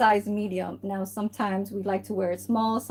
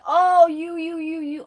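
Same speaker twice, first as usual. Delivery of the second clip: loud high opening, stretched into a mocking run; mock busybody outburst; 7 semitones higher, 6 dB louder than usual. Vlogger mimics the kind of talk she avoids, mocking. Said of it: "I don't talk like that".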